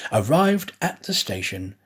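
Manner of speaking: plain reading voice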